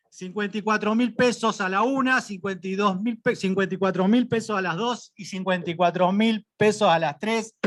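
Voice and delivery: brisk rising bid calls; auctioneer. Acted. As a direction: call the bids like an auctioneer. Auctioneer calling bids. (pregonero de remate)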